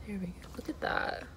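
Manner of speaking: hushed whisper at the food. Real, not acted. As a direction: breathe it out in a whisper. Showing the sandwich.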